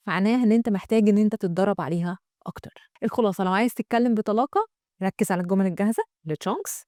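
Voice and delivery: usual speaking voice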